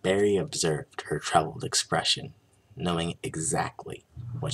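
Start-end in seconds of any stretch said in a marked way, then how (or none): none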